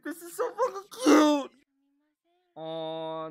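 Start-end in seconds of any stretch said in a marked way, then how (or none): 0.0-1.5 excited squeal. Real, not acted. Reacting to cute anime scene.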